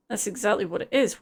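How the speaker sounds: usual speaking voice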